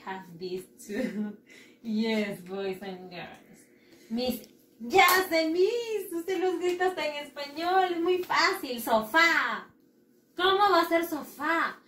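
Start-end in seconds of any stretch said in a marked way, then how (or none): 4.1-9.7 know-it-all pupil. Acted. Pupil telling Miss it is too easy, excited. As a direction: play a pupil blurting out the easy answer.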